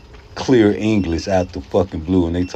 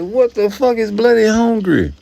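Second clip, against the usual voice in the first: higher, louder baffled question; 5 dB louder than usual. Retold or imitated. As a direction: act stunned at the strange phrase. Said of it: "I'm like".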